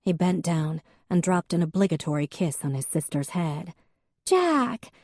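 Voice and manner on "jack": high, bright call of his name; Faith greeting. A narrator's reading. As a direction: sister greeting her brother. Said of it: "Faith said".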